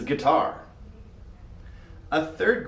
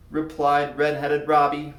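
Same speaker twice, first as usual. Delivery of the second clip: ordinary delivery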